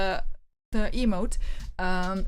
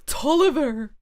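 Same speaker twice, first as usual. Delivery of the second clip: surprised; 4 semitones higher, 5 dB louder than usual. Acted. Gasp on recognizing man.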